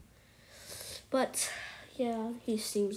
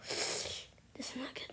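whisper; 12 dB quieter than usual. Real, not acted. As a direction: whisper it, nervous. Scared while playing.

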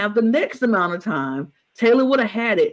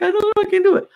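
[name]'s mock self-doubt; 14 semitones higher, much faster than usual.

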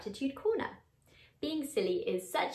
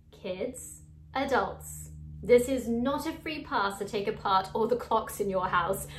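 usual speaking voice

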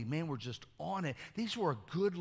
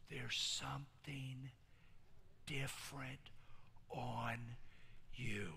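drawn-out hushed whisper; slow whisper; 2 semitones lower, 12 dB quieter, slower than usual. Acted. Pastor speaking a blessing over a listener, slow whisper. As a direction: lean in and breathe it slowly.

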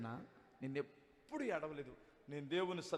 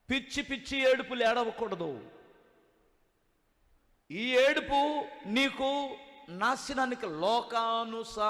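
fiery preaching; 8 semitones higher, 10 dB louder than usual. Acted. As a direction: preach it hard to the congregation. Pastor preaching on weeping.

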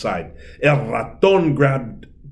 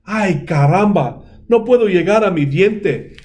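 frustrated mouse; 8 semitones higher, 5 dB louder than usual. A narrator's reading. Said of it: "El Ratón Pérez dijo".

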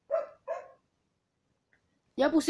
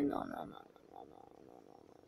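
breathless whispered no no no; hushed panic under the breath; cursing under breath; 18 dB quieter than usual. Real, not acted.

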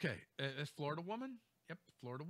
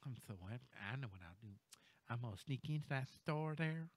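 softer, sneaky plotting voice; scheming mock whisper; 4 semitones lower, 9 dB quieter, faster than usual. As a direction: quietly plot like a sneak. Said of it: "real meal deal story. Ready?".

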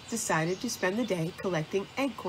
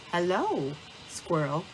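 ordinary delivery

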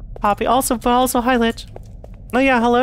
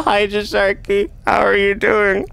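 ordinary delivery

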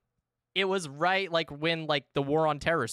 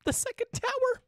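high-pitched giggling punchline; cracking up at your own joke; laughing through it. Real, not acted. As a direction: giggle fit at a dark joke. Laughing at her own dark joke.

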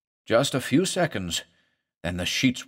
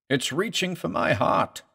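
pained, higher plea through a groan; a touch of a groaning patient; 7 semitones higher than usual. A narrator's reading. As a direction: push the words out through the pain. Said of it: "he groaned".

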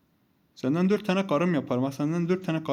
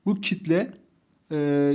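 ordinary delivery

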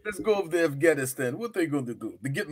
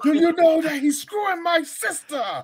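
mock Paulie voice; 9 semitones higher than usual. Voicing Paulie scheming in story.

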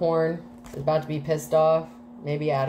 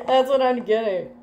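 normal talking voice

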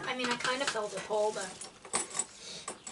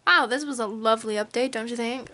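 ordinary delivery

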